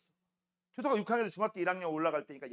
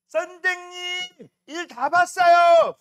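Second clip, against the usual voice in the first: high, slow, loud sing-out; little kid calling; 10 semitones higher, 11 dB louder, much slower than usual. Retold or imitated. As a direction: imitate a small child hollering from the toilet. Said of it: "뭐라는 줄 알아, 걔가?". (화장실에서 선생님을 길게 부르는 꼬마 흉내)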